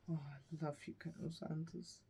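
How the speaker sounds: very soft retold love line; dichiarazione d'amore recitata. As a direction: murmur a mock declaration of love. Retold love declaration in plot.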